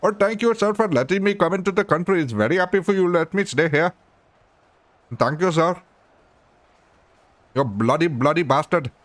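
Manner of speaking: high-pitched, exaggerated Indian accent; hammed-up grateful Indian accent. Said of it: "I can bloody do an Indian impression".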